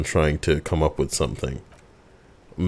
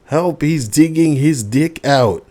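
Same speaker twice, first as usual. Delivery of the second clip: put-on kid yelling; 10 semitones higher than usual. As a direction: shriek it to the whole street. Kid yelling accusations at windows.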